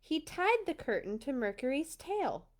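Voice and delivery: usual speaking voice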